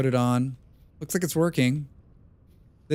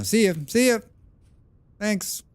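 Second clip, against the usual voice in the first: sing-song goodbye; 8 semitones higher than usual. Real, not acted. Calling out a goodbye.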